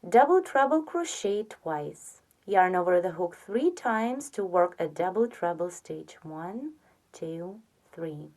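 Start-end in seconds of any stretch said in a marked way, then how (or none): 6.2-8.3 quiet murmured count under the breath; soft counting. Real, not acted. Counting stitches for crochet viewers, softly murmured.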